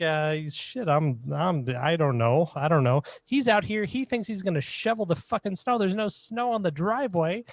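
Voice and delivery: high, rushed panicky excuses; flustered. Retold or imitated. Passer-by scolded by the old woman, high flustered pleading. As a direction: babble defensively, caught off guard.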